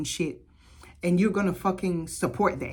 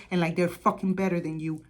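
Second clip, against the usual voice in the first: usual speaking voice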